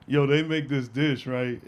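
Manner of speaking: usual speaking voice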